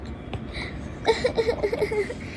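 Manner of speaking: high, soft stuttering sobs; put-on boo-hoo whimper; fake crying. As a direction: sob in short pulses. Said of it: "You're gonna make me cry".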